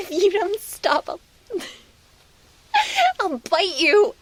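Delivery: high, breathless giggling protests; laughing pleas. Acted. Speaker being tickled by listener, high giggling pleas. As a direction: beg them to stop through the laughter.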